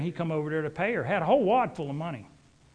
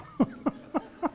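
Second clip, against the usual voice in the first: airy laugh; 10 semitones higher than usual. Real, not acted. Laughing at cow named Monica.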